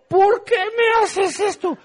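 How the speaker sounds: high, slow pained complaint; whiny blind man. Retold or imitated. The blind man complaining to Jesus, high and pained. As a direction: whine it like mud burns your eyes.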